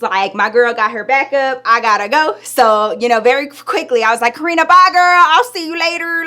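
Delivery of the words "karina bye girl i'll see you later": high, loud, sing-out farewell; called-out goodbye. Retold or imitated. Leaving her friend in a hurry, calling goodbye. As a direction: toss a loud bye over your shoulder.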